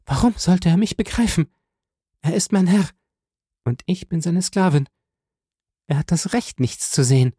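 high, anguished woman's voice; bitterly submissive, high and pained; heartrending. A narrator's reading. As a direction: answer with heartbreak, pitched high. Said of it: "entgegnete mit einem herzzerreißenden Ausdruck".

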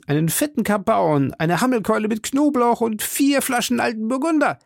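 very high-pitched, declamatory command; grandiose banishing outburst. A narrator's reading. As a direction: banish the servant with theatrical fervour. Elated master theatrically banishes servant. (exaltiert und theatralisch befehlend)

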